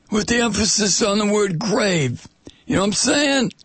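high, put-on Italian mobster voice; mafioso Italian accent. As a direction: menace politely like an Italian-American mafioso. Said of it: "that mafioso kind of Italian".

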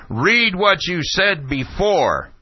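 high, slow mock shout; Ashcroft shouting. Red-faced official ordering the questioner, slow imitated shouting. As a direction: drag the order out at high pitch. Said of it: "red-faced and shouting".

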